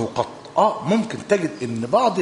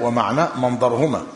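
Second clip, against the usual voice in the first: usual speaking voice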